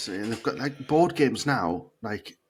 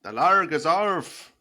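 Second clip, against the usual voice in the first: put-on drawn-out, higher Scottish accent; 5 semitones higher, 3 dB louder, much slower than usual. Scottish host shows co-host how to say it, Scottish accent. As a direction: stretch it out in broad Scottish. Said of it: "The lager's off in a Scottish accent".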